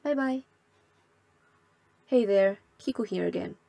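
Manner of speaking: ordinary talk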